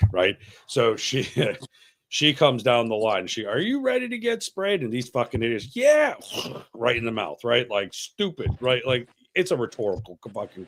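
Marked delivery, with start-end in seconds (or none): 3.5-4.8 mock instructor. Instructor voiced in story. 5.8-6.1 squeaky high eager yeah; high mock-eager yes; eager idiots.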